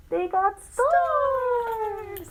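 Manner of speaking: high, slow, drawn-out delight; excited. Real, not acted. Parent asking a child, high and drawn-out with excitement.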